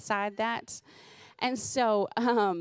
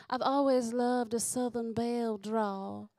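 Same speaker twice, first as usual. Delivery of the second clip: genteel Southern belle accent; Southern belle drawl; 2 semitones higher than usual. Shown as a demonstration. Comic showing off accents she uses with her kids, Southern belle drawl. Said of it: "I've always talked in accents".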